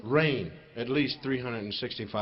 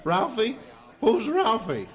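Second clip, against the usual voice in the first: higher, louder startled question; surprised tiger; 8 semitones higher, 6 dB louder, slower than usual. Acted. Tiger startled to hear Ralphie was killed, high and loud. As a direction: jump at the unfamiliar name.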